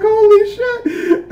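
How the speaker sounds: high, slow squeal through laughter; laughing disbelief. Retold or imitated. Retelling watching the fight, high laughing squeal. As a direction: choke out the words mid-laugh. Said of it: "I was like".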